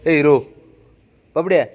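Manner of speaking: loud call to the pet. Real, not acted. Owner calling the pet, loud call. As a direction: call out to him firmly.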